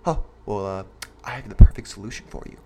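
motivational pitchman. Pretend. Host mocks quick-fix motivators to his listeners in a low, hushed salesman voice. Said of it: "I wish I could sit up here and tell you".

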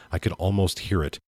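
ordinary delivery

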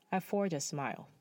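usual speaking voice

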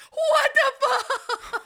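high, slow, laughing shout of shock; shocked outburst. Retold or imitated. Claire seeing the dead woman breathe, shocked laughing shout. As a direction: stretch it out, half laughing in disbelief. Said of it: "and Claire's like".

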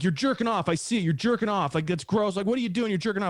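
high-pitched, agitated accuser; mock accusing. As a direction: imitate someone outraged and disgusted. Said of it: "he accused me of".

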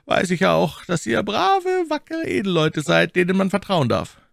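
high-pitched, courteous man of power; commanding cardinal. A narrator's reading. Voices the cardinal in narration.

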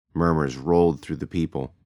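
ordinary talk, nothing marked

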